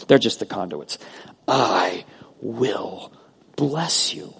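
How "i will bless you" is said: "I will bless you" word by word; emphatic promise. Acted. Preacher voices God promising the people, "I will" emphatic. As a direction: stretch "I" and "will", pausing before "bless you". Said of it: "going out of his way and saying".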